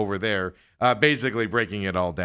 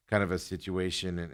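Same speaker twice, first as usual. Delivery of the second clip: ordinary talk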